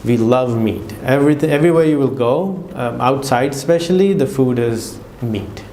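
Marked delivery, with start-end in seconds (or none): none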